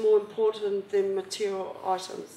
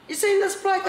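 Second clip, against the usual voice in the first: high, pressing question at the bar; urgent question; 10 semitones higher, 3 dB louder than usual. Retold or imitated. Mother showing a photo at the pub, high urgent question. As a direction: demand an answer fast. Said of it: "I said to them at the bar".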